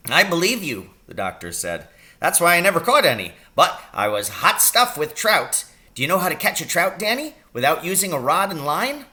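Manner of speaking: a brisk country doctor fussing over his patient; little old doctor voice. A narrator's reading. Doctor asking his injured patient about the pain.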